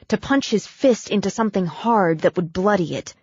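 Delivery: plain reading voice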